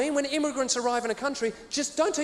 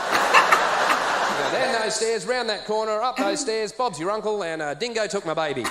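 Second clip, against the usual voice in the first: broad Aussie; 3 dB louder, slower than usual. Pretend. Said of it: "I Aussie it up".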